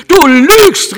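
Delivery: put-on little girl accusing the doctor, furious and bitter; passionate bitterness. A narrator's reading. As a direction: hurl the accusation in bitter rage. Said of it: "in leidenschaftlicher Erbitterung".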